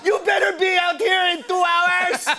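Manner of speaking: high, shouted mother's warning; hammed-up angry mom yelling an order; imitated mom. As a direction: scold the kids in a shrieky mock-mom voice. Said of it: "so she had to drive around".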